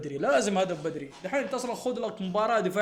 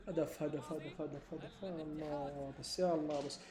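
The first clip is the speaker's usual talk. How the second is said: muttered in despair; 6 semitones lower, 16 dB quieter than usual. Real, not acted. Muttering after conceding a goal.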